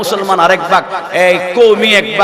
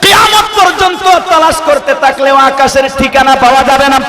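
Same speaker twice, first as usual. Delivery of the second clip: preaching at full force; 10 semitones higher than usual. Acted. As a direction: cry it out to the crowd.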